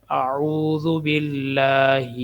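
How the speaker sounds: اعوذ باللہ slowly with a thin lam; careful recitation. Shown as a demonstration. Teacher demonstrating correct pronunciation, slow recitation. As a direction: recite slowly, every letter clear. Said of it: "ہا موٹی ہا ہے".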